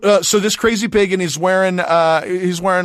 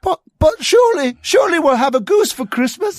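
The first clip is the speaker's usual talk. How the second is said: put-on child voice. Child voice pleading.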